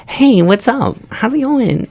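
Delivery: very high, mock-girly falsetto; girl's friendly voice. Man retelling how a girl greeted him, mock-girly high voice. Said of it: "that's how she sounded to me".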